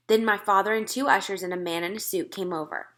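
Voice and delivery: ordinary delivery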